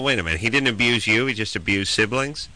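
ordinary talk, nothing marked

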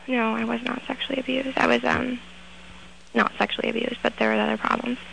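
ordinary talk, nothing marked

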